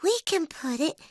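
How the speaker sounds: high, bright cub voice with a good idea; little bear voice. Acted. Little Brown Bear suggesting the bowl to Mom, high cub voice. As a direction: sound like a small bear proud of his plan. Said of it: "He says".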